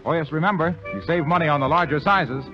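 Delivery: ordinary talk, nothing marked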